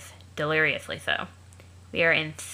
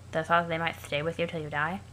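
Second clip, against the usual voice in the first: ordinary delivery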